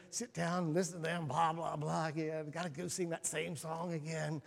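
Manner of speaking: usual speaking voice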